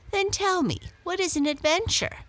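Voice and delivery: daring Blackie to explain; challenging cat. A narrator's reading. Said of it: "asked Muffins".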